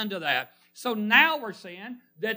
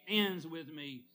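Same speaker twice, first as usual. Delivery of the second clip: soft drop after a big build-up; hushed landing; 9 dB quieter, slower than usual. Retold or imitated. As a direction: let it fall quietly and finally.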